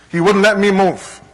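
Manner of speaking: normal talking voice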